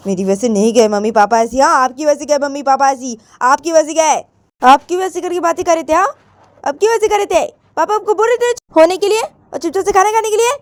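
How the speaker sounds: building from near-normal to high, loud protest; Poonam, arguing back. Acted. Daughter blaming mummy for papa leaving, indignant.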